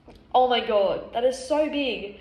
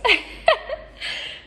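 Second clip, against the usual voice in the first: high airy laugh, crying too; real breathless laugh; 12 semitones higher than usual. Real, not acted. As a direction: laugh breathlessly through tears. Laughing, nose running.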